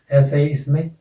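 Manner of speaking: ordinary talk, nothing marked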